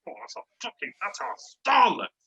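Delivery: high-pitched, emphatic fan raving; worked-up retelling of his own cheering; retold fan outburst. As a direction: rave about it, pitch jumping way up. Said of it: "I'm like".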